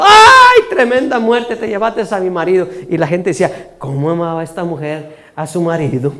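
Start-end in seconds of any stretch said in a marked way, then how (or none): 0.0-2.7 widow's cry, fading. Acted. Preacher retells the widow's cry, mock shriek quickly subsiding. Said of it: "pegaba a la mujer el grito". 3.8-6.1 lower, softer murmur of admiration; hushed onlookers. Acted. Neighbours comment on the widow's love, in hushed admiration. Onlookers remark among themselves.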